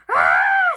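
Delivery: very high, very loud shriek; scream. Acted. Polly screaming at the spiders. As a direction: shriek in horror. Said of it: "screamed Polly".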